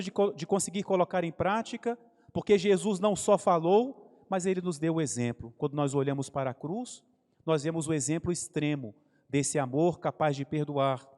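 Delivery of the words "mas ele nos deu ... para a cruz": usual speaking voice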